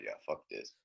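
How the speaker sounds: low, very quiet dismissive aside; retold grumble, dropped low and soft; fed-up mutter. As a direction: mutter it under your breath, fed up. Retells muttering to himself, fed up.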